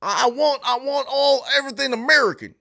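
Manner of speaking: high, loud all-American twang; mock American patriot. Streamer hamming up an American for the chat, mock patriot. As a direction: crow it like an over-the-top patriot.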